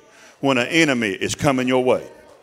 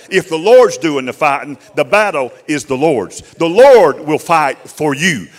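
preaching; 2 semitones higher, 10 dB louder than usual. Real, not acted. Preacher driving it home to the congregation, louder. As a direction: press each line home to the church. Preacher builds to full force.

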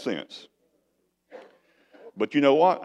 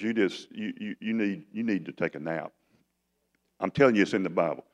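soft and low, kindly; a hint of a gentle father; 6 semitones lower, 4 dB quieter than usual. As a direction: coax him tenderly. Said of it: "He's so gentle with him".

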